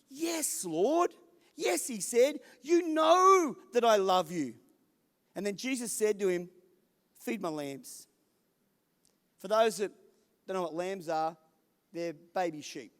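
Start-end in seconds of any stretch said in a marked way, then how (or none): 0.1-4.5 high, louder, earnest declaration of love; fervent reply. Acted. Peter's fervent answer. 7.2-8.1 softer, lower, tender instruction; gentle command. Acted.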